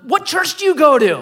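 high, loud mock inquiry; rescuer calling down. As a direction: shout the question down to him. Rescuer calls question down.

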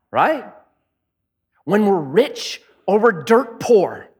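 ordinary talk

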